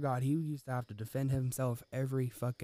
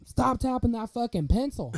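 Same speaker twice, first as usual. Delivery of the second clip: retold scream; 8 semitones higher, 5 dB louder than usual. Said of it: "He fucking screams at the top of his lungs".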